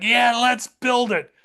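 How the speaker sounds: high, drawn-out eager yell in imitation; mock enthusiasm. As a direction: play an eager leader loving a silly idea. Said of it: "Hitler loved this idea".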